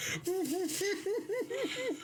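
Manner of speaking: high, breathy cackle with a mouthful; high wheezing laugh. Retold or imitated. Telling friends about the full mouth, high muffled cackle. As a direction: cackle like your mouth is stuffed. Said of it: "And I'm like".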